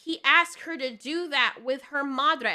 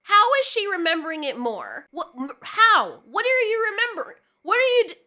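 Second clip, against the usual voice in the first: exasperated yelling; 5 semitones higher, 7 dB louder than usual. Real, not acted. Ranting at the show.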